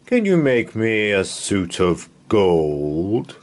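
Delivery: a lightly voiced king, slow and grand. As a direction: ask it like a stately king. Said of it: "said the King".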